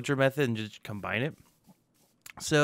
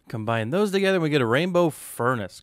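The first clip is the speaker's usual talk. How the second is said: loud, punchy announcement; excited announcement; 3 semitones higher, 8 dB louder than usual. Real, not acted. Gamer revealing the rainbow furnace to viewers, excited.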